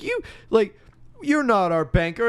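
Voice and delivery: high, drawn-out mock protest; protesting townsfolk. Townsperson objecting to the villain at his house, high mock protest. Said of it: "runs all the people away".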